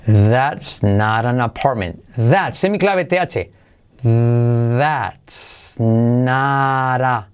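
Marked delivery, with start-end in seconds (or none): 0.1-2.0 slow, with the T of "not" as a soft R, "apartment" without its T; slow model with "not" tapped like "nar". Shown as a demonstration. Teacher models for Spanish learners, slow with soft R. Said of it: "la T se convierte en R suave". 4.0-7.3 drawn-out model phrase. Shown as a demonstration. Teacher breaks the sentence down for learners, very slow. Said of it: "T como R suave".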